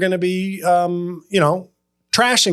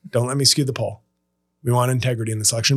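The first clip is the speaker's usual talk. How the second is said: mock serious; 6 semitones lower, 3 dB quieter, much faster than usual. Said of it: "repeated lower and quicker".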